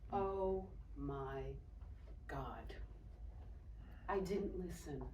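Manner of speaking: soft, low, weighted pauses; hushed realisation. Retold or imitated. Woman realising she ignored the warning, hushed. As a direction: let it sink in, very quietly. Sobering realisation after the escape.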